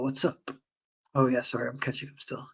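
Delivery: ordinary talk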